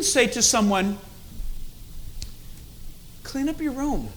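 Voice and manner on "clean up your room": soft, gentle ask to tidy up; unthreatening request. Shown as a demonstration. Parent asking a child to tidy the room, unthreatening. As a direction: ask softly, without any threat. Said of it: "That doesn't sound very threatening".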